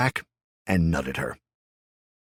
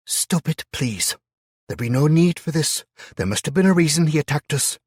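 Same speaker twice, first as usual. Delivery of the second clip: Hannah's voice; 11 semitones higher, 8 dB louder than usual. A narrator's reading. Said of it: "Hannah interrupted softly".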